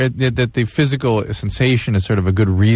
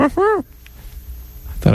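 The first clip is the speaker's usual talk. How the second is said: Senor Wences voice. Retold or imitated. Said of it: "more like Senor Wences".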